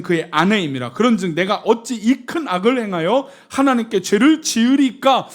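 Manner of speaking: loud, declaimed refusal; forceful scripture reading. Acted. Joseph refusing his master's wife, forceful scripture reading. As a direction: proclaim the verse at full voice.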